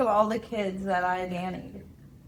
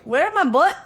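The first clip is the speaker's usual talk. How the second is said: grandma voice; 6 semitones higher, 11 dB louder than usual. Retold or imitated. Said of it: "you're just like".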